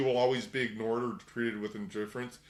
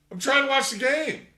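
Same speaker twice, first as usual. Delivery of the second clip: much higher and louder, a frustrated yell; exasperated yell; 13 semitones higher, 8 dB louder than usual. Pretend. Husband watching the Super Bowl yells at his wife, high and loud. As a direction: shout back defending the game. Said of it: "he's like".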